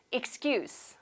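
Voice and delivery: "excuse" ending in a voiceless S sound; noun pronunciation with S. Shown as a demonstration. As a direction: model "excuse" as a noun, softly, ending on a hissed S. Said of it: "명사기 때문에 S 발음으로 하셔야 됩니다". ("excuse" 끝을 S 발음으로)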